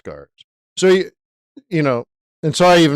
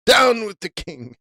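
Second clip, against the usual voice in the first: mock revolutionary; 9 semitones higher than usual.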